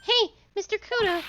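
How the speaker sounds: high, loud hail; calling after the sulking old man. Acted. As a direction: shout to catch his attention.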